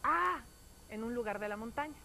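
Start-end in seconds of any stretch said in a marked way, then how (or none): 0.0-0.4 high, loud cry thrown back; a ringing, higher echo shout. A narrator's reading. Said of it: "oye una voz repitiendo".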